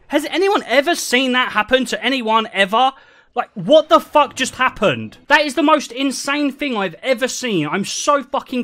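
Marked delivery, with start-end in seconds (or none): none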